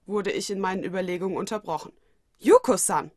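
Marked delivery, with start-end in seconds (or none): 2.4-3.1 louder, startled exclamation of her name; surprised call. Acted. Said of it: "rief ich überrascht aus".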